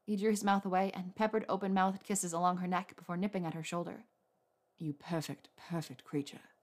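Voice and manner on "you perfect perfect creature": softer, lower, breathed out in awe. A narrator's reading. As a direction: murmur it like a secret against her skin. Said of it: "he breathed".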